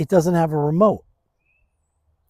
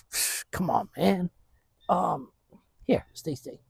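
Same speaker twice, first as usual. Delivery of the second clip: softer, near-whispered commands to stay; hushed stay command; soft dog commands; 5 semitones higher, 8 dB quieter than usual. Real, not acted. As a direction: quietly settle the dog. Settling the dog.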